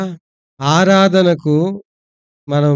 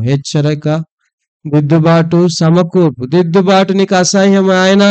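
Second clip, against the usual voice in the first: loud, urgent prayer to the Father; fervent prayer; 4 dB louder than usual. Acted. Pastor praying aloud for his congregation, loud and fervent. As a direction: pray aloud over the church, pleading.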